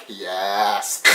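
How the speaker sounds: low, quiet, drawn-out mock Santari yes; a hint of a smug recruiter; deep yes. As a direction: drop deep and soft for a sly yes. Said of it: "And Santari's like".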